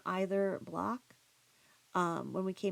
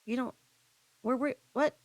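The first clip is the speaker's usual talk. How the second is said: high, louder broken-off questions; retold shock; 6 semitones higher, 6 dB louder than usual. Husband realizing wife missed the shooting, imitated stunned stammer. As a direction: imitate stunned, halting disbelief. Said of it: "all standing there in shock".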